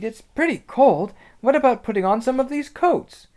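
high, loud character voice; high-pitched girl proposing a plan; chilly Susan. Acted.

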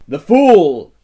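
loud, high scornful cry; cried out in scorn. A narrator's reading. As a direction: spit out her contempt for him. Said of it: "she cried".

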